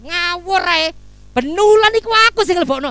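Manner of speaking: loud, high-pitched mock outburst; hammed-up indignant protest; indignant retort. Acted retort to accusation of stinginess.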